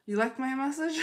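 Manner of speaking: high, loud question tipping into laughter; amused, playful. Real, not acted. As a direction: ask it brightly, about to laugh. Jokes with viewers, then laughs.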